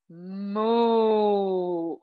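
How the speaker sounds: long, low imitated moo; cow moo. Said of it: "Let's do the cow pose".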